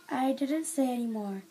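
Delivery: ordinary delivery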